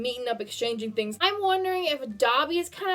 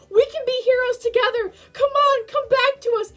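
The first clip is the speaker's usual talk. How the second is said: lightly voiced pleading, higher and louder; begging Aizawa; 8 semitones higher, 5 dB louder than usual. Aizawa begging his friend to come back, mild pleading. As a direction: plead with a dead friend, half acted. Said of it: "He's, like, begging him".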